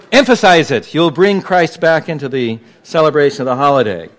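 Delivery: ordinary talk, nothing marked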